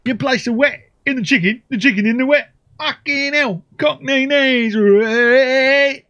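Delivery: high, hammed-up Cockney caricature; mock Cockney voice. Player explaining batter as a Cockney, high mock Cockney accent. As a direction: squawk it like a cartoon Cockney. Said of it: "Obviously, I enjoy that voice".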